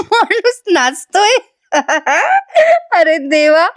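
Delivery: words breaking into high laughter; can't stop laughing; laughing hard. Real, not acted. Laughing uncontrollably at the joke. (खळखळून हसत बोलणे)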